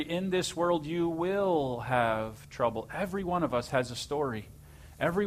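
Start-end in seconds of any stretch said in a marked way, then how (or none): 0.0-2.9 slow solemn quoting of scripture; slow, weighty scripture quote. Retold or imitated. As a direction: read it slowly like a verse. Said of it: "John sixteen thirty-three".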